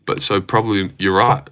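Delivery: ordinary delivery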